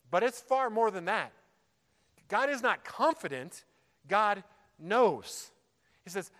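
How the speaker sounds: fervent sermon delivery; preaching with force. Real, not acted. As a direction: drive the point home from the pulpit. Preacher building to full force.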